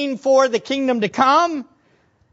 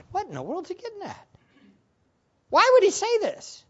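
high-pitched mock bewilderment; baffled; 8 semitones higher, 4 dB quieter, faster than usual. Preacher voices a confused listener questioning Jesus, baffled. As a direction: puzzle over it in a high voice.

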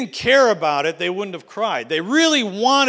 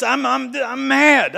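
mock anger; 4 semitones higher, 3 dB louder, slower than usual. Voicing the angry people.

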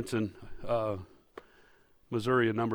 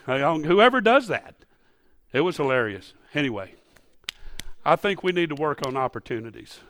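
ordinary talk, nothing marked

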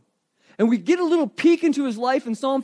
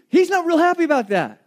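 ordinary delivery

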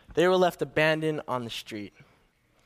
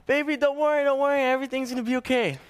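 reassuring mom; 8 semitones higher, faster than usual. Retold or imitated. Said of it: "my mom's like".

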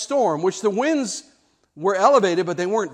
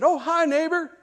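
cheery greeting; 9 semitones higher than usual. Retold or imitated.

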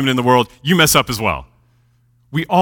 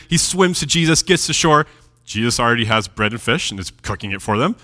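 usual speaking voice

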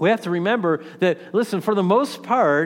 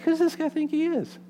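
much higher, mock-offended scoff; indignant; 11 semitones higher, 3 dB quieter than usual. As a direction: play the insulted rafter, huffy and high. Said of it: "I'm like".

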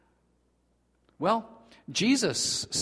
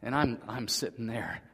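usual speaking voice

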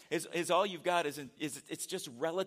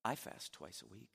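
quiet smug Pharisee; 7 semitones lower, 9 dB quieter than usual. Acted. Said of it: "he points and he says".

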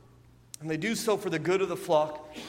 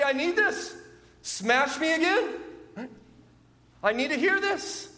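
eager plea; 10 semitones higher than usual. Pretend. Voicing an eager listener's heart.

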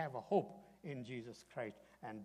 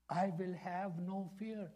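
higher, louder, slow proclamation; slow, declaiming; 6 semitones higher, 5 dB louder, slower than usual. Shown as a demonstration. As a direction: declare it slowly with conviction. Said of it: "like you really mean it".

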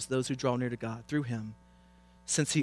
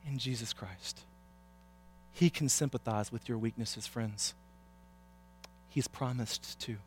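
tender pleading; 10 dB quieter than usual. Real, not acted. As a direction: plead softly, leaving long pauses. Tender pleading appeal to congregation.

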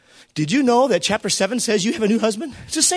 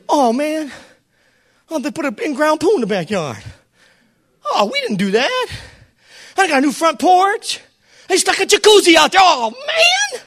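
mock envious homeowner, rising to a high cry; envious ex-owner; 6 semitones higher than usual. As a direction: ham up the envy of the old house. Voicing envious former owner.

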